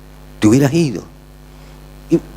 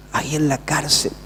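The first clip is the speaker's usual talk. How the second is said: dropped to a soft repeat; quiet echo; 2 semitones lower, 6 dB quieter than usual. Shown as a demonstration. Preacher echoing his own line to the congregation, quietly. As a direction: let the words ahí en la cárcel fall away softly.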